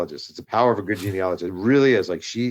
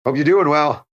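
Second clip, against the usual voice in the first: higher, louder, falsely bright chirp; mock cheery sign-off; 7 semitones higher, 7 dB louder than usual. Reading his own awkward text aloud, in a mock cheery chirp. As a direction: sweeten the awkward text with a fake-cheery ending. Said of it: "super lame text".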